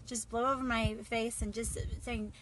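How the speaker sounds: usual speaking voice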